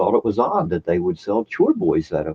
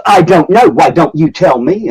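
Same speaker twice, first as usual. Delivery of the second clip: higher and louder, a mock sarcastic shop lady; snippy clerk; 6 semitones higher, 8 dB louder than usual. Shop clerk snapping back at a customer, mock sarcasm. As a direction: throw it back like a rude clerk. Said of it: "She goes".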